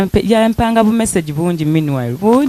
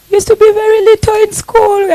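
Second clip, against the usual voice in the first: much higher, louder and squeaky, as a tiny schoolkid; squeaky little kid voice; 6 dB louder than usual. Acted. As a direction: pitch way up to paint how small she was. Describing friend as tiny schoolkid.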